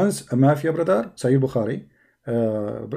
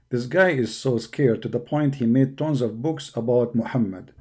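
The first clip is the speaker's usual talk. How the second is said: usual speaking voice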